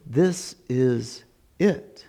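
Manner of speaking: very slow, low-key "this is it"; accepting criminal. Acted. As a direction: let each word settle in realization. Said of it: "And this one was going".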